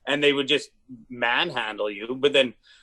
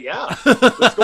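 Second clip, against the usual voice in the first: eager and laughing; 9 semitones higher, 9 dB louder, slower than usual. Retold or imitated. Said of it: "I was like".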